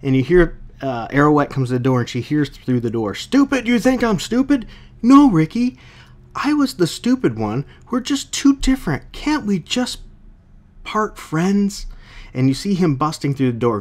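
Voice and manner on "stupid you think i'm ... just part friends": higher, lightly voiced woman pleading; pleading counselor. Reviewer reads the counselor begging Ricky to part friends, pitched up. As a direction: plead with the ex through the door.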